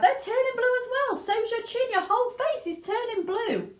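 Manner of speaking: very high frantic cry; rising horror; panicked mother. Acted. Mother lists Violet's blue features, panicking. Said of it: "screamed Missus Beauregard".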